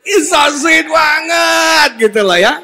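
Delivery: high, loud, slow gush of delight. Real, not acted. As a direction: squeal it with delight. Gushing over the sweet moment. (berseru gemas, tinggi dan keras)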